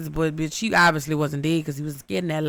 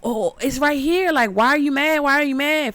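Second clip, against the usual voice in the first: Kalina's innocent act; 8 semitones higher than usual. Retold or imitated. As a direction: play dumb, sweetly, to the angry man. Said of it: "And she like".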